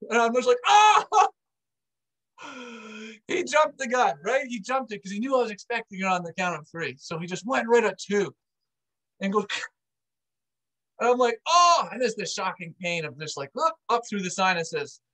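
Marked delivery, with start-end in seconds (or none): 0.7-1.3 sharp, high, loud yelp of pain; yell of pain. Retold or imitated. Nose cracked back into place. 11.5-11.9 high, loud pained "Oh!"; pained gasp. Retold or imitated. Shocking pain through the sinuses.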